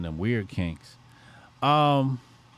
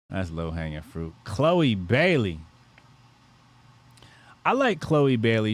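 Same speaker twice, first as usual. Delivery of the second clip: ordinary talk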